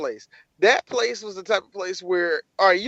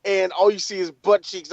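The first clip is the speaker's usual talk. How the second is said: ordinary talk, nothing marked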